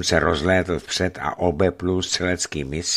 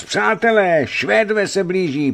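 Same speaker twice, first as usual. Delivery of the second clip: high, loud alarm cry; frantic high shout; loud shouted warning; 13 semitones higher, 6 dB louder than usual. Acted. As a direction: yell the warning up at the walls. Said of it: "hlasitě křičel".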